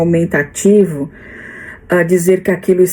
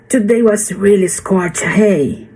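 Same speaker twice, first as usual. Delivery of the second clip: Australian accent; 2 semitones higher than usual. Shown as a demonstration. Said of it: "o legal é você".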